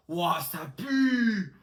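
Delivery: long drawn-out cry of disgust; hammed-up disgust. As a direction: overact the stink, stretching it out. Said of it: "c'est quoi cette actrice". (dégoût surjoué)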